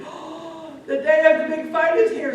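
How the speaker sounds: high, excited outburst after a gasp; thrilled announcement. Acted. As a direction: proclaim the long-awaited event. Crowd hears the fight starting.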